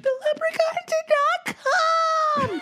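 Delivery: high, wailing mock sob of a child; crying kids. Kids crying to mom that the leprechaun skipped them, high mock sob. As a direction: wail it like a heartbroken kid. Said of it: "They all came in crying".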